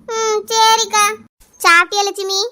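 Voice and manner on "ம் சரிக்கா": sniffly, acted crying voice; tearful assent. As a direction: give in with a drawn-out whimper. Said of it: "அழாத லட்சுமி". (அழுகையோடு சம்மதம்)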